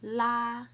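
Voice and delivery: "La" held high and level; first tone. Shown as a demonstration. Teacher showing the four tones, "La" high and level. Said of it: "I'll say the four tones".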